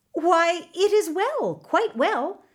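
high, startled stammer; flustered potter. Acted. Potter flustered by the prime minister's question. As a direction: fumble for words, caught off guard.

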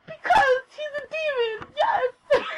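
very high, drawn-out ecstatic cry; reenacted fangirl shriek of joy; overjoyed squeal. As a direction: squeal it slowly, as if it's obvious and wonderful. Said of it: "I was just really fucking happy".

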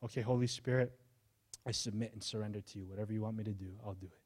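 hushed, soft prayer of surrender; quiet surrender prayer. Pretend. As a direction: pray it quietly, giving in.

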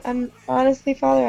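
usual speaking voice

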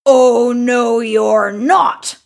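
loud troll refusal; forceful monster voice, drawn out; big hairy troll. A narrator's reading. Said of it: "said the big hairy troll".

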